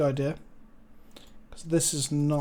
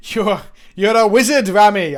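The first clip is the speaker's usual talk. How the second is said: loud put-on Scottish accent; mock-Scottish proclamation; 7 dB louder than usual. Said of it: "I shouldn't do Scottish accents".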